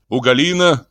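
ordinary delivery, nothing marked

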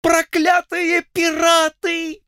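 very high panicked yell; falsetto scream. Acted. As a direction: shriek in falsetto. Colonel keeps shrieking. (крик фальцетом)

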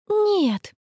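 high, plaintive groaned no; high-pitched exasperated wail; moaning. A narrator's reading. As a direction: moan it in protest. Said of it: "простонала Конни".

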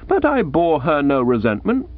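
ordinary delivery

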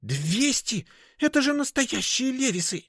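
squealed indignation; indignant whistling squeal. A narrator's reading. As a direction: whisper breaking into a very high squeal. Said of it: "от возмущения даже сорвалось с шёпота на свистящий".

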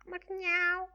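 high, cat-like meow. Acted. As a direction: mew like a cat wanting milk. La gatta miagola. (la gatta chiede attenzione con un miagolio acuto)

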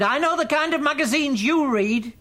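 high, loud taunt; shouted accusation. Acted. Mother outside bedroom door accusing son, shouted accusation. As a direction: hurl it accusingly at the top of the voice.

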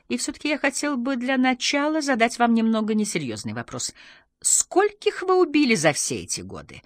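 animated, high talk-show host; TV presenter. A narrator's reading. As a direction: lead up to a teasing question on air. Presenter questions guest.

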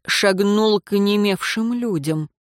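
ordinary delivery, nothing marked

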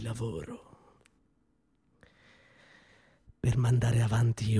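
a low, bitter murmur of reproach; grave, hushed accusation. Acted. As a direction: let each word sink in quietly.

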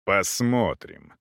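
plain reading voice

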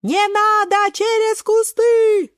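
high, loud, drawn-out cry; shouting. A narrator's reading. Friends begging Шпинатик to avoid bushes, long high shout. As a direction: yell it high, stretching the words. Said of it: "кричат все в один голос".

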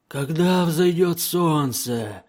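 high, slow, eerie demon wail; howling gurgling voice. A narrator's reading. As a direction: draw it out like a fearful gurgling daemon. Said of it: "Лихорадочно воющими и булькающими голосами".

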